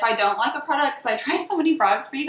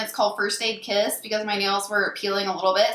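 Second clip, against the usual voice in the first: ordinary talk, nothing marked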